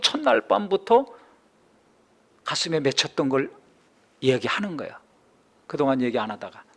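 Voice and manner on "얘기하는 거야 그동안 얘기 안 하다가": normal talking voice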